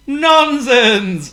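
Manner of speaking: high, loud breezy dismissal; dismissive. Acted. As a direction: wave it away. Potto dismissive.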